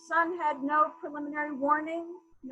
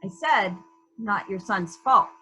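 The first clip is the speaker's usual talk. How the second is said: louder, each word pressed home; firm raised repeat; 4 semitones lower, 6 dB louder than usual. Acted. Inspector repeats himself to the mother, louder and pointed. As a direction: insist she hears it this time. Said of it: "I heard you".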